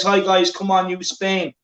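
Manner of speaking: ordinary delivery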